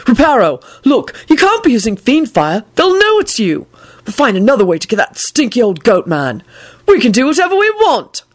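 high elated cry. A narrator's reading. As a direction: burst out with joy. Neville voiced, high.

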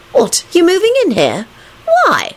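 very high incredulous questions; bewildered. A narrator's reading. Rosie asks Neville about moving in, high bewilderment. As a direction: let the pitch leap in puzzlement. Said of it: "in a genuinely bewildered tone".

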